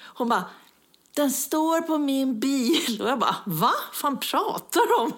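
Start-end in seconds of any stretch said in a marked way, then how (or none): 1.1-3.0 very slow, higher put-on voice; mock laborious announcement; slow-talking neighbour lady. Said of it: "En dam som pratar så långsamt". 3.5-5.1 higher-pitched incredulous retort; mock-exasperated disbelief; baffled disbelief. Retold baffled reaction to neighbour.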